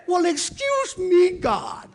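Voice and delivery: loud, high mock-offended; outraged shout at God; indignant excuse me. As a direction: shout it like you can't believe it.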